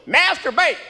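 high, slurred Southern yell; sudden high-pitched bark of an order; waiter's yell. Retold or imitated. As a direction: yell it like a Southern waiter, words run together. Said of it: "He just yells at me".

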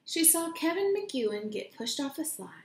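ordinary delivery

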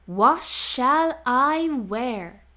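drawn out, puzzled and slow; slow wondering. Acted. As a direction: ponder it slowly. Animals wondering about outfits.

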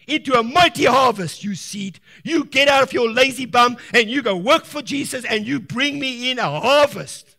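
loud, rising preacher's command to the seed; loud commanding call to a planted seed. Acted. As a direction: order the seed to grow, full voice. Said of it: "We're gonna say".

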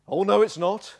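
loud, high panto contradiction; sing-song playful denial, projected; pantomime retort. Acted. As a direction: chant it back like a panto audience. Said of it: "it's almost pantomime".

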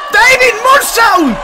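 slow, high, loud call of the move; excited shout. Real, not acted. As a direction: stretch the move name out in a roar. Commentator calls a big move. (grito emocionado alargado)

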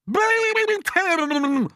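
long, piercing high yell; loud cry. A narrator's reading. Aunt Sponge joining in against James, crying loudly. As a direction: stretch it into a shrieked cry. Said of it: "cried Aunt Sponge".

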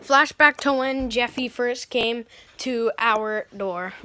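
ordinary talk

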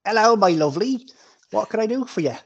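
high, chirpy innkeeper greeting; welcoming innkeeper. Acted. Game master voices the welcoming innkeeper.

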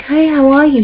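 mock cheery greeting. Menelaus greeting the captive women, mock cheery. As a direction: breeze in as if nothing's wrong.

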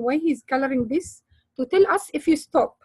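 ordinary delivery

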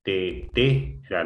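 "Tetera" slowly split as te-te-ra; syllable by syllable. Shown as a demonstration. Teacher testing the stressed syllable, syllable by syllable. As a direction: sound out "Tetera" in slow pieces. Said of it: "la sílaba tónica".

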